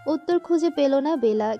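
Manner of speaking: ordinary delivery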